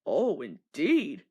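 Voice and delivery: slow, low and stern; stern boss drawing out the words; the Fat Controller rebukes the rude engines, slow and stern; severe. A narrator's reading. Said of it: "said the Fat Controller severely".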